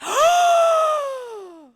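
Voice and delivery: a huge high gasp of delight; loud excited gasp. Real, not acted. Streamer seeing her idol join, loud shocked gasp. Guest Hellscream joins the call.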